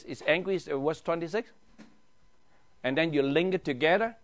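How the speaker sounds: ordinary delivery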